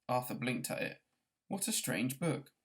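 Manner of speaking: ordinary delivery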